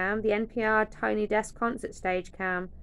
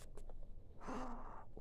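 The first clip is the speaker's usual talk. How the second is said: gasp; 4 semitones higher, 15 dB quieter than usual. Real, not acted. Gasp at photo card fit.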